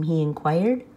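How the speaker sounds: usual speaking voice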